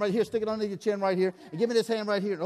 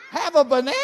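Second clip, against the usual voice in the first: mock disbelief; 6 semitones higher, 9 dB louder, faster than usual. Mishearing magic word, louder.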